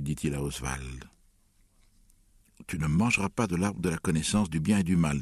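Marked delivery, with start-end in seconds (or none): none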